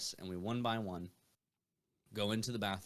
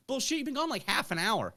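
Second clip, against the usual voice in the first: friends; 12 semitones higher, 3 dB louder than usual. Retold or imitated. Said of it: "They're like".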